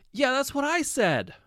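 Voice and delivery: high, loud, drawn-out I-told-you-so; vindicated. A narrator's reading. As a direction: crow that you were right all along. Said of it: "sounding vindicated".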